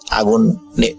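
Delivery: ordinary delivery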